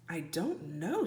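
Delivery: soft, low reply; bewildered. Acted. Jack answers Annie, quiet and bewildered. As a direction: answer softly, at a loss.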